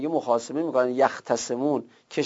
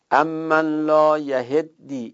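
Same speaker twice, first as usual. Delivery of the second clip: slow Quran recitation; much slower than usual. Shown as a demonstration. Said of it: "این یهدی یعنی یهتدی".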